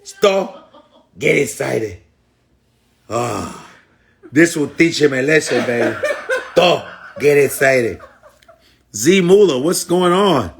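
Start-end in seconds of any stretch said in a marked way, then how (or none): 0.0-8.0 commenter imitated in broken, Spanish-accented English. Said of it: "he was on that shit going".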